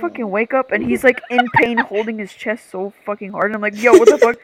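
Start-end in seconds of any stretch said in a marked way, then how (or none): 3.8-4.4 loud, retold panicked outburst; freaking out. Retelling waking to a boyfriend in pain, freaking out. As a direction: act out a startled freak-out. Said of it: "I'm, like, freaking out".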